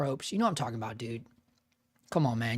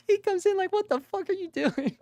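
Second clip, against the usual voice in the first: much higher mock-baffled quote through laughter; laughing retelling. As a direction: imitate a baffled question while cracking up. Retold studio man, teller laughing.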